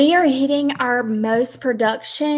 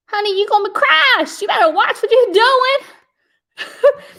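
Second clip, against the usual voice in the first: mock scolding; 8 semitones higher than usual. Scolding a rubbernecking driver.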